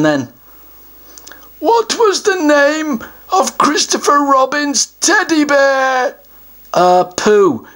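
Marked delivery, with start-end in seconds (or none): 1.6-6.2 pompous, higher troll voice; a self-important troll puffing himself up; troll guardian. Acted. Troll announces he guards the treasure, pompous higher voice.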